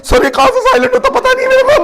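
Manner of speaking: very high helpless aunty voice; flustered aunty. Retold or imitated. Aunty admits to trainer she can't silence phone, very high and flustered.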